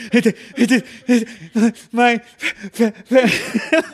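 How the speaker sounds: mock sob-stutter of a little kid trying to talk; crying stutter. Shown as a demonstration. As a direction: show how a crying kid chokes on every word. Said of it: "when you're crying and you're like".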